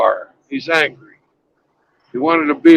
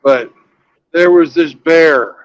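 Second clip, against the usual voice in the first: ordinary talk, nothing marked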